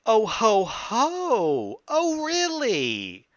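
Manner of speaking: high, loud, drawn-out "oh really"; gleeful surprise. Acted. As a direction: ham up a delighted fake surprise. Gloating over a rival's request.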